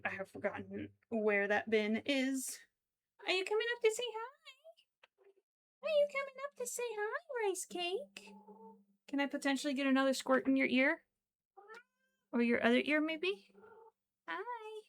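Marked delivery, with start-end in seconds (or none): 3.2-8.3 very high, fast coo. Real, not acted. Owner calling her pet Rice Cake over. 14.3-14.8 high soft hi. Real, not acted. Owner greeting her pet softly.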